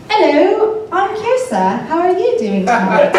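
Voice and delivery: put-on high, flirty British accent greeting. Woman chatting up guys in a bar, high fake British accent. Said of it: "I've ever used a British accent".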